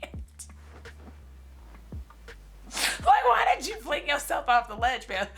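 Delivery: laughing through the words, voice jumping high; cracking up mid-rant, real laughter. Real, not acted. Ranting to viewers about the movie, laughing hard.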